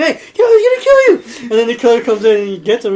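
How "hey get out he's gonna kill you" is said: much higher and louder, cracking up; loud laughing warning at the TV; laughing mock shout. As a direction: yell the warning while laughing. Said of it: "the T V reflecting".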